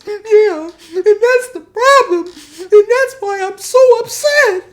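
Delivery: hammed-up loud falsetto crying; Josh. Josh explaining why he's upset, exaggerated falsetto wail. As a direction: wail the complaint in a very high voice. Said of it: "Josh is crying and stuff".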